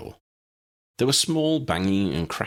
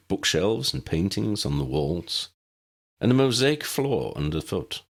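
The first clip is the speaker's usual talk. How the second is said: ordinary delivery, nothing marked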